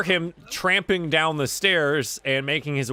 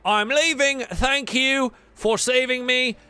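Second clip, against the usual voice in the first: higher-pitched, indignant sailor walking out; ship hand insisting on leaving; 7 semitones higher than usual. Acted.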